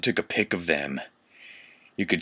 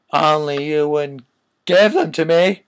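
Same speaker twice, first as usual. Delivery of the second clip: higher, louder hammed-up shout; mock angry shout; 5 semitones higher, 6 dB louder than usual. Reading the fanfic aloud, a hammed-up angry shout. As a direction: throw in a hammy angry yell. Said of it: "Lumpkin shouted angrily".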